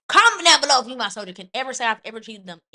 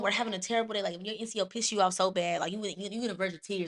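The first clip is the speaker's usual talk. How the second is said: normal talking voice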